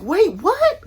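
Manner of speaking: high, loud panicked wait, what; alarmed. Retold or imitated. Told she's about to be taken in, panicked high shriek.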